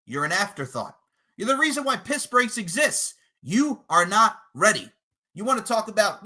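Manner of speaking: ordinary delivery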